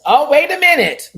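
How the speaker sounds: loud, high-pitched holler; startled-sounding high shout; sudden yell. Real, not acted. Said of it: "let me not yell at you".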